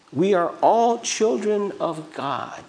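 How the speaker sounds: high, slow, stretched-out platitude; exaggerated dreamy imitation; mock sweetness. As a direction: make it sound oh so lovely, mockingly. Repeats the nice-sounding saying mockingly.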